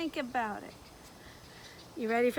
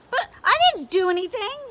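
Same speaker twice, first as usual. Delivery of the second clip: higher, louder protest; defensive lizard; 7 semitones higher, 10 dB louder than usual. Acted. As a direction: insist on your innocence. Leon protests.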